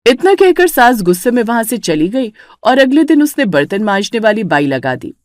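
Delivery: ordinary delivery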